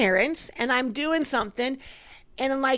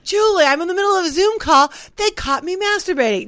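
fast, high panicked coworker; mock panic, quick and high; panicked quote; 6 semitones higher than usual. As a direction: rush it out like a frantic caller.

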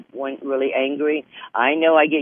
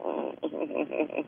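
breathy whispered laugh; 3 semitones higher, 11 dB quieter than usual. Real, not acted.

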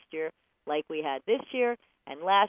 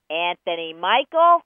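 much louder, slower scolding call; in-trouble call; 12 dB louder than usual. Acted. Mama calls out a child in trouble, loud scolding. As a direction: hit both names hard, like an angry parent. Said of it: "you are in trouble".

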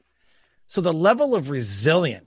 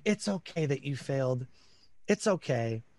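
soft, hushed mock soothing; coddling parent; 2 semitones lower, 7 dB quieter than usual. Mocking parents who excuse failure, soft and coddling. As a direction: imitate an overgentle parent, voice dropped.

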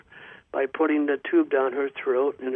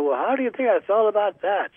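pitched up, a mock-offended child scolding the doctor; retold indignant retort; 6 semitones higher than usual. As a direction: toss it back at him like a hurt little girl. Revived girl scolds the doctor.

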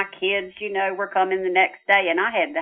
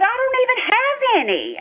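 much higher, disbelieving; incredulous objection. Retold or imitated. Daughter denying she has spirituality, high disbelief. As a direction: laugh it off as absurd. Retold protest to father.

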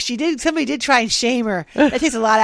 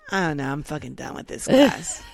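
Mom's deep voice; 6 semitones lower, 3 dB quieter than usual. Retold or imitated. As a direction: lower gruff grumbling as Mom. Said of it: "she's like".

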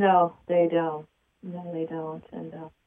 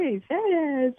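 higher, cheerful tone to the cat; happy pet voice; 9 semitones higher, slower than usual. Retold or imitated. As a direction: sweet-talk the cat with a rude word. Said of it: "he's going by the tone of my voice".

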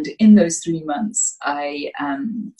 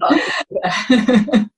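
bright real laugh; 7 semitones higher, 5 dB louder than usual. Real, not acted. Guest laughs at her own early idea, real laugh. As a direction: burst out laughing at herself. Laughs at being ahead of time.

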